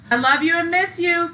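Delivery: ordinary delivery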